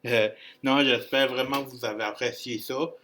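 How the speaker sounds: ordinary talk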